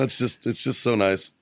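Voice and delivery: ordinary talk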